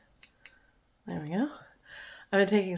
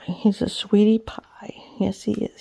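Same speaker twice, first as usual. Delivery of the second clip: whispered cooing; 3 dB louder than usual. Real, not acted. Owner praising her damp dog, whispered cooing. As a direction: coo it to him in a whisper.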